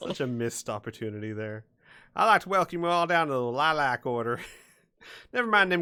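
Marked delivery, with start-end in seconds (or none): 2.2-5.8 put-on Florida accent. Game master playing the Florida family welcoming visitors, loud Florida accent. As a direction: play it as a loud Florida host. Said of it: "like, the Florida family".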